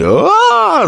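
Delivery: scream of pain. Acted. The summoner screams after the shot, high and loud. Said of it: "заорал призыватель".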